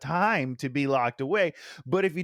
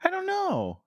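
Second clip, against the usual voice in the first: high, unsure guess. Real, not acted.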